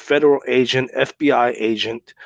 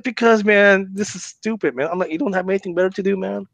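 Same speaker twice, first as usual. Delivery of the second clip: high, fed-up scolding, rushing the middle; retold exasperated scolding; 7 semitones higher than usual. Man retelling how he scolded the prank caller, exasperated. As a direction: tell him off like he's wasting your time.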